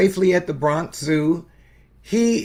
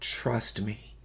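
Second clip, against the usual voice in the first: much softer, hushed and gentle; tender reassurance; 6 semitones lower, 11 dB quieter than usual. Retold or imitated. Father softly tells scared daughter to trust him. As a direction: quietly reassure a frightened child. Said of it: "he said".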